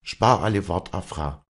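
loud, high start dropping on the name; stern. A narrator's reading. Wally refusing Afra's plea, stern. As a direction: cut off the pleading strictly. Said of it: "sagte Walli streng".

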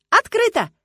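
very high, loud shout toward the door. A narrator's reading. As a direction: call out to the visitor at the door. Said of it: "прокричала она".

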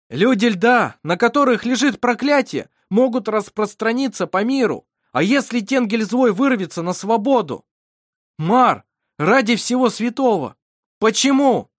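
loud, high, impassioned plea; desperate appeal. A narrator's reading. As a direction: implore him at full voice, pitched high. (отчаянный громкий призыв)

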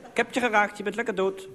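put-on high child's voice, gloating; playful child triumph; little boy. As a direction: crow it like a kid who won. Little cowboy boy shouting.

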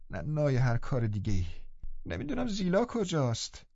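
high, soft, complaining invalid; peevish sickly boy. A narrator's reading. Linton complaining to the visitors, high and peevish. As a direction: fret about the cold door.

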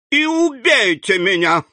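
high, desperate cry of a ruined banker; exclaiming. A narrator's reading. Banker Danglar cries out to the bandit chief, high and desperate. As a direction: fling the words out in despair. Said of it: "воскликнул Данглар".

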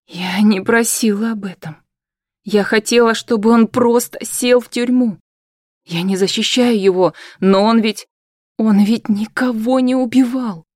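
put-on upset young woman. Hanna protests, rising.

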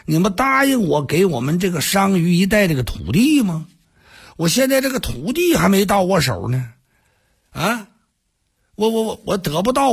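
anxious, tripping pleading; flustered protest. Acted. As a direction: stumble over the words like a cornered envoy. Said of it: "冯丑富说".

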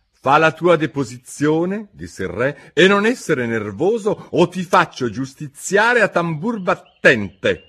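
a king pushing his jury; stern king. Acted. King urging the jury, booming.

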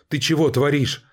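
high, alarmed cry; horrified shout. Acted. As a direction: shout it in horror. Horrified at the shooting. (испуганный крик)

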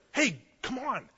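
high, called-out coaxing; mock-eager crowd call; eager urging. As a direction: egg someone on in a high voice. Crowd urging the beggar forward.

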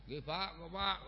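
soft, distracted child answer; child's soft reply. Child answers father calling him to eat, soft and distracted. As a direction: murmur back without looking up.

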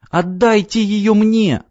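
высокий, медленный детский голосок; тоненько выкрикнуть, как маленькая девочка; тонкий детский выкрик. A narrator's reading. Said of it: "тонко выкрикнула девочка". (девочка тонко умоляет отдать нецке)

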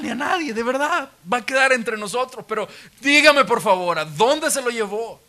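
a mildly hammed-up pleading woman; pleading Mary. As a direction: beg him as if bargaining with a stranger.

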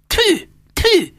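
high spitting tfu tfu. A narrator's reading. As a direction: spit to ward off evil. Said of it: "köpött Róza mama". (köpködő tű, tű)

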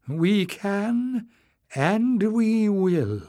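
high, slow, deliberate vow; resolute. A narrator's reading. Knowles standing up to the leader, high and resolute. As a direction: draw out each word with resolve.

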